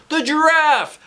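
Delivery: high, loud yelled answer; kids yelling. Acted. Kids answering their teacher, yelling loudly. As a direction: yell it out across the room. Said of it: "other kids yelled".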